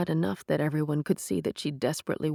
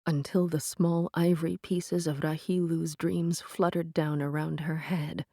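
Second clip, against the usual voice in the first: usual speaking voice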